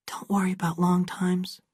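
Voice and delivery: soft reassuring whisper, no voice. Acted. Abra to Aaron under the tree, whispering. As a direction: breathe it to calm him.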